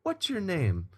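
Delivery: high-pitched imitation of a star asking. Star asking the opener who he is, lightly imitated. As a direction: put on the star's high, easy question. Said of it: "He's like".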